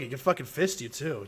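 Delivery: normal talking voice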